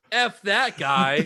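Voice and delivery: slow, loud, high mock scorn; mock dismissive. Joking about a dead friend, loud slow scorn. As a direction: drag it out like a jeer at a grave.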